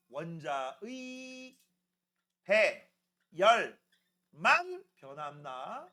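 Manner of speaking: very slow, word by word with gaps; writing-along dictation. Real, not acted. Teacher dictating what goes on the board, very slow and drawn out. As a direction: stretch each word to the pace of the pen. Saying words while writing them.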